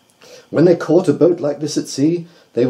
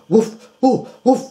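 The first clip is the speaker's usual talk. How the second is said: higher light woof woof; barking; 11 semitones higher than usual. A narrator's reading. Dreaming dog Jip barking, lightly voiced. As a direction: bark the woofs like a dog. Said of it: "he barked loud".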